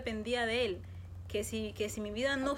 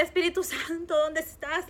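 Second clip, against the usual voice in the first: retold shout, higher and louder; 5 semitones higher, 4 dB louder, slower than usual. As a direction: yell his name while searching. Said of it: "lo gritaba".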